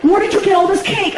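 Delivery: high, loud imitation of girlfriend. Girlfriend grilling him about cakes, high and loud. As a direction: demand answers in a high girlfriend voice. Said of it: "and she's like".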